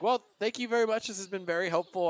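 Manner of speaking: high, loud, overly polite thanks; hasty politeness. Acted. Player thanks the old man while escaping, high and bright. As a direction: brush him off sweetly while leaving.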